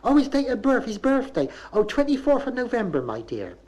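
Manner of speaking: high rising Bristol mother's voice. Retold or imitated. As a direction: lift every line up like a question. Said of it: "She said".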